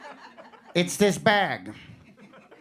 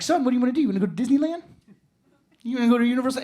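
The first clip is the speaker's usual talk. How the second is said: higher, coaxing dad voice; eager dad; 6 semitones higher, much faster than usual. Retold or imitated. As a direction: lightly play a dad pleading with his son. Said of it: "and he's like".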